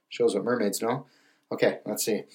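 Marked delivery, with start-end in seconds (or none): none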